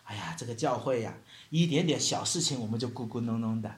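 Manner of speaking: low, soft mock muttering; imitated grumbling. Preacher mimics members complaining about church, low muttered grumbling. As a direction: grumble under your breath like a churchgoer. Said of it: "我们来到教会也会咕咕哝哝的".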